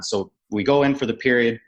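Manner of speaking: ordinary talk, nothing marked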